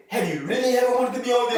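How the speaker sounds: high, fast mock ad pitch; rapid, lifted commercial-announcer question; radio commercial voice. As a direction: imitate an advert pitching eagerly to the listener. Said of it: "and, I, and it said".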